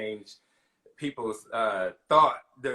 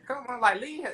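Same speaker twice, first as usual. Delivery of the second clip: high protective plea; 12 semitones higher than usual. Retold or imitated. Defending her to critics.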